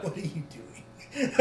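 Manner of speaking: slow laughing question. Real, not acted. Laughs at partner's act.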